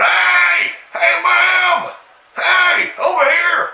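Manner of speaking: high calling shout; shouting across the road to a jogger. Retold or imitated. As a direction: holler to get her attention.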